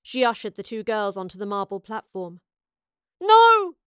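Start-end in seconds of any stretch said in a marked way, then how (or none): 3.2-3.8 very high, loud anguished yell. A narrator's reading. Astrid watching the professor board the ship, yelling. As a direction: yell to stop someone leaving. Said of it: "Astrid yelled".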